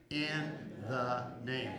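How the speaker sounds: very slow, measured phrase; congregational prompt. Shown as a demonstration. Preacher asking congregation to repeat with him, slowly. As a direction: draw out each word so they can follow. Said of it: "Would you say that with me".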